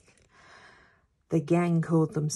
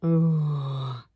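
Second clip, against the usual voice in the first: loud, low disappointed groan; group groan; 3 semitones lower, 5 dB louder than usual. A narrator's reading. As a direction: groan like an unimpressed gang. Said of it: "The gang groaned".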